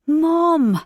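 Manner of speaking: high, loud mortified yelp; embarrassed protest. Acted. Teenage son stopping his mother's gushing, loud mortified yelp. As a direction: cut her off, cringing.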